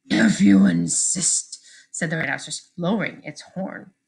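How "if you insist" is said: much lower, slow beast voice; deep rhino voice. Acted. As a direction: rumble it out like a rhino.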